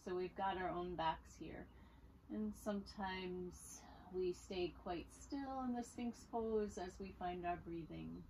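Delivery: calm, unhurried instruction with long pauses; guided yoga voice. Real, not acted. Yoga teacher easing students into Sphinx pose, calm guiding voice. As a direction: softly guide them through the pose, leaving room to breathe. Leads a gentle yoga practice.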